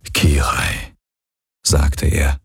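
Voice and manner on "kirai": almost tender. A narrator's reading. Hox takes Kirai's hand and says her name, almost tender. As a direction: say her name gently, low. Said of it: "fast zärtlich".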